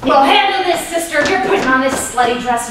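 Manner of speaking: high, loud mock-angry retort; hammed-up angry sister; mock fury. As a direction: fire it back at her. Staged quarrel retort.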